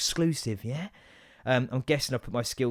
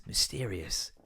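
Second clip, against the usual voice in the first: ordinary talk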